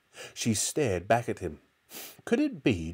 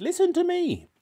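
put-on very high, insistent old woman; bossy grandmother; 4 dB louder than usual. A narrator's reading. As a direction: demand it in a pitched-up granny voice. Grandmother ordering George about.

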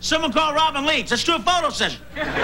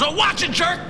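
rude inner voice; 6 semitones higher than usual. Retold or imitated. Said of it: "that little voice inside you goes".

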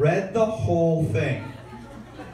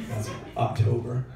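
ordinary talk

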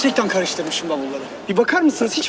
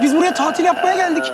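ordinary talk

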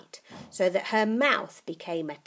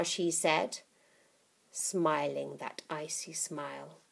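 usual speaking voice

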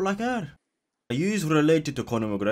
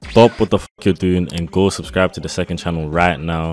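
loud, lower-pitched hard-sell plug; channel promo; 5 semitones lower, 14 dB louder than usual. Real, not acted. Streamer telling viewers to subscribe to second channel, loud promo. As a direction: belt out the subscribe plug at full volume.

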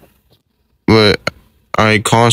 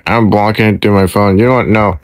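ordinary talk

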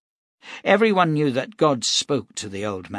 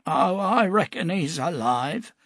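put-on high old man's voice, wry; old prophet, knowing; 7 semitones higher than usual. Cade to the captain, wry old prophet. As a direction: sure of what he knows.

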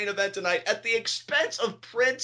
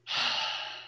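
weary sigh; 7 dB quieter than usual. Retold or imitated. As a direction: let out a heavy fed-up sigh. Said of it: "I got my sighing voice on".

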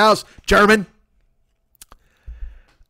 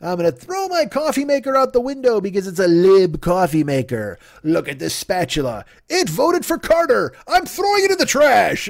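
hammed-up angry nut, pitched up and yelling; mock outraged fan; 4 semitones higher than usual. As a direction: yell like a fool trashing his spatula. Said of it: "everybody's stupid".

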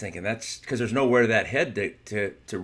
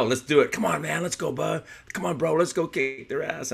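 eager egging on; 7 semitones higher than usual. Retold or imitated. Mark urging his friend to fight the bouncers, a lightly voiced eager push. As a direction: hype a buddy into a fight. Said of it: "Mark, he was ready to go".

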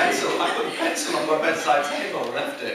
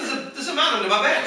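put-on higher, hurried disbelief as a small boy; alarmed disbelief; 7 semitones higher, faster than usual. Boy questions mum about the bogeyman, rushed and alarmed. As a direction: blurt it in mock alarm.

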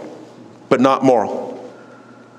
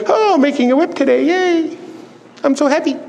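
high, fake-cheery singsong; hammed-up happiness, pitched way up; mock-happy Jesus; 11 semitones higher than usual. Preacher mocks a happy Jesus making a whip, fake cheer. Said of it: "Do you think he was happy?".

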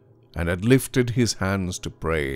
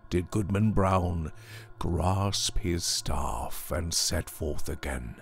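ordinary delivery, nothing marked

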